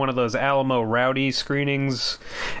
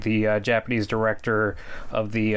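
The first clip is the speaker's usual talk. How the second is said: normal talking voice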